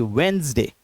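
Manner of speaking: वेडनेसडे said "wens-day", D silent; correct pronunciation. Shown as a demonstration. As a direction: squeeze वेडनेसडे into two syllables, wens-day. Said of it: "डी और नेस silent है".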